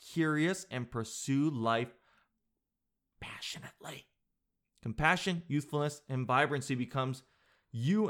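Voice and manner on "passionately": hushed, theatrical whisper; dramatic whisper. Pretend. Host reads a green-eyes profile, dramatic whisper. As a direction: whisper it for mock-intense effect.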